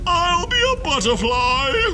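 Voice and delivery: put-on very high, loud lilting old lady voice; fluttery lady. Missus Wilkins announces she'll be a butterfly, high and lilting.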